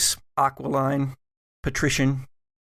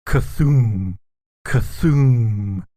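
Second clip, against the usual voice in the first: loud distant creature cry; monster call; 6 dB louder, much slower than usual. Acted. GM voicing a creature heard through the manor, loud. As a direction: boom out an eldritch call.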